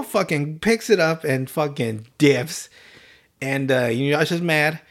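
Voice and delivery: usual speaking voice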